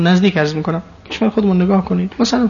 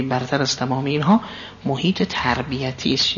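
ordinary talk

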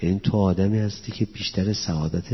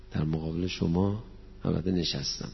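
usual speaking voice

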